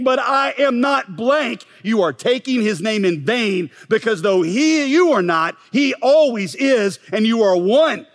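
impassioned preaching. Acted. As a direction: preach it hard, pleading with the congregation. Preaching build through fears.

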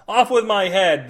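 loud, slow royal shout; mad king ranting. Acted. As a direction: bellow the order at the guards. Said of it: "the king screamed at the other guards".

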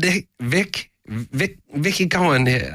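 British accent. Shown as a demonstration. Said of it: "Let's hear that".

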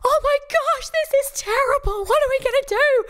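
hammed-up hysterical surgeon; panicking doctor. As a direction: play a doctor losing it, hands on head. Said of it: "physician going".

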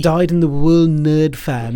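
slow, put-on British voice; generic British accent; mock British accent. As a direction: draw it out in stock British. Reprises the mock British quote.

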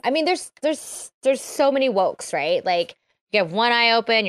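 ordinary talk

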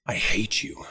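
breathy hiss of self-hatred; a cold whisper inside his head; whispered inner voice. Retold or imitated. Inner voice whispering hatred at himself, hushed. Inner voice during panic attack.

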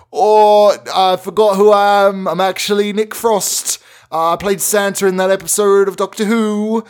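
high, loud flustered British claim; panicked backpedal. Retold or imitated. Caught lying to the CEO, flustered and loud. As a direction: blurt it out in a rush of panic.

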